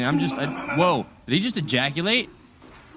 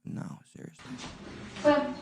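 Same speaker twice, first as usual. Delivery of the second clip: whisper; 9 semitones higher, 10 dB quieter, much slower than usual. Real, not acted. Whispered aside.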